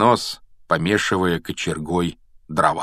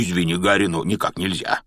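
usual speaking voice

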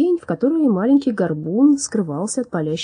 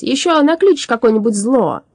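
raised, louder scolding; displeased shout; 6 semitones higher, 6 dB louder than usual. A narrator's reading. Alchemist warning the hooting ghost, displeased shout. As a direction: scold crossly at raised pitch. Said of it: "недовольно закричал Парацелиус".

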